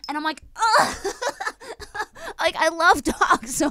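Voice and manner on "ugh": high-pitched mock yelp of pain; comic "ugh", pitched way up. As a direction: imitate yelping as a dog bites. Retold reaction to dog clamping arm.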